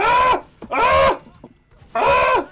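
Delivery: three very high, loud screams; panicked screams. Real, not acted. Gamer under fire, screaming high and loud. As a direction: scream in panic as he's hit. Getting shot in game.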